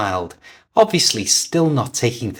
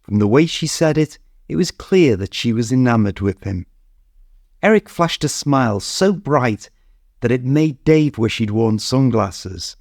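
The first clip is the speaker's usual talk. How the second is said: usual speaking voice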